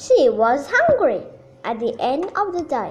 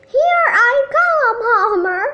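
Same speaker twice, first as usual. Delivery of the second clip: loud high call ahead; hollering; 9 semitones higher, 6 dB louder than usual. A narrator's reading. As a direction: shout it up the hill. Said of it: "she hollered ahead".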